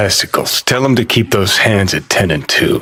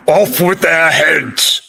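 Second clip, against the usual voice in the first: slow hammed-up pompous Brit; a light mock of an old wig-wearing lord; mock shout; 7 semitones higher, slower than usual. Mocking old Brits, he slowly hams up a higher shout. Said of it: "old Brit farts in powdered wigs shouting".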